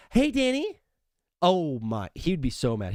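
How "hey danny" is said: Hey, Danny said much higher with a rising lilt; teasing high greeting. Pretend. Host teasing coach Dan Campbell with a high, rising greeting. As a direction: sing Hey, Danny up high to tease him. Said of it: "or if I said it like this".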